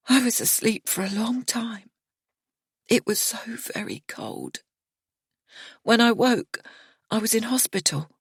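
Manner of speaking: lightly voiced grieving woman; Miriana, numb and hurt. A narrator's reading. Miriana recounting the hospital to narrator, numb and hurt. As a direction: tell it dazed and wounded.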